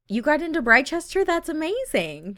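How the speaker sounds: high, delighted congratulations; excited. Pretend. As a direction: play a thrilled boyfriend. Boyfriend congratulates her.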